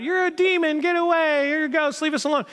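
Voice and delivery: high-pitched, strung-out cry of alarm; mock panicked yelling. Peter yelling at the supposed ghost on the water, mock panicked. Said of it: "like, yelling out".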